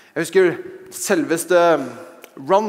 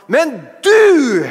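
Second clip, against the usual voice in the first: "du" punched high and loud; emphatic cry; 7 semitones higher, 7 dB louder than usual. Real, not acted. Preacher turning to address God, loud emphasis on "du". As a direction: land on "du" hard.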